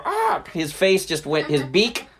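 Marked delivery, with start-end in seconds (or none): 0.0-0.4 short, high squawk; mock startled bird shriek; cut-short seagull scream. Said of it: "didn't even get to go".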